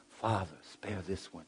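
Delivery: a hushed, humble plea retold; asking voice. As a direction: ask it gently, as if begging the Father. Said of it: "it was an asking voice".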